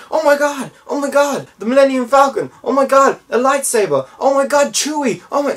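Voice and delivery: high, hammed-up squealing fan voice; gushing fanboy. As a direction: gush like an overexcited fan spotting every icon. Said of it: "the fans who are like".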